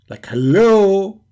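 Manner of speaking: impatient sarcastic call; exaggerated is anyone there; mocking hello. Mock impatient calling.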